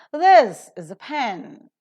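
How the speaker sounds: "This is a pen" with a loud high THIS, aspirated PEN, weak "is a"; stress on THIS and PEN. Shown as a demonstration. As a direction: hit THIS and PEN, puff the P, reduce "is a". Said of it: "how do we pronounce the example".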